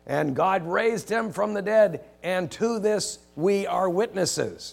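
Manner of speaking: solemn loud witness; proclaiming. Acted. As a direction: announce it slowly as testimony. Quoting Peter's proclamation.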